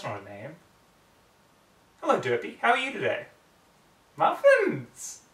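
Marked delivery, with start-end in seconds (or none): 2.0-3.3 higher, louder friendly voice; cheerful greeting. Acted. Twilight greeting Derpy, higher and louder. 4.2-5.2 very high, loud goofy squeak; Derpy voice. Acted. Derpy shouting to Twilight, very high and loud.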